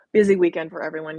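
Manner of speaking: ordinary talk, nothing marked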